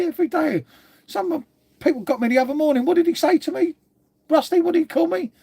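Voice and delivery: rising indignation, voice jumping high; worked up. Real, not acted.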